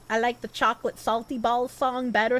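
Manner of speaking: ordinary delivery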